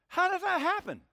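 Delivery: high, loud, baffled outburst; incredulous question. Acted. Acts out Nicodemus's puzzled question.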